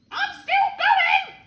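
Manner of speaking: slow, high, loud screech; old screechy voice. A narrator's reading. Grandma from up in the attic, old screechy voice. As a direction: screech it down from above. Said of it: "came the old screechy voice from up above".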